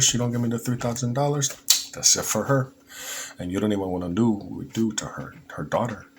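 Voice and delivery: imitating a coyote in a bad Mexican accent; lightly put-on Mexican accent, menacing. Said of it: "that's my worst Mexican accent".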